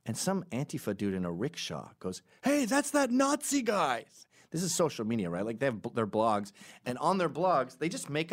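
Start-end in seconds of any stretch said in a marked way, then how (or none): 2.4-4.0 high, slow shout of recognition; hammed-up accusing yell; mock yell. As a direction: call out an accusation across the street. Antifa guy calling out from rickshaw.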